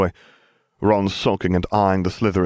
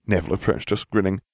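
plain reading voice

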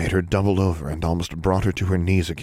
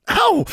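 sharp high yelp of pain; pained cry; 5 dB louder than usual. A narrator's reading. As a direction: cry out as your head hits. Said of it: "Alexandra cried".

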